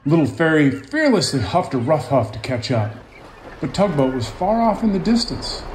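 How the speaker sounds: lively picture-book narration; storybook read-aloud voice; reading to children. A narrator's reading. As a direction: tell it gently to young listeners.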